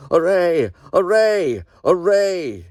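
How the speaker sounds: high, loud ringing call to arms; thunderous battle cry. Acted. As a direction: cry it out like a voice from heaven. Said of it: "a shout louder than a thunder peal, crying".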